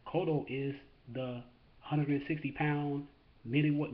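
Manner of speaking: ordinary delivery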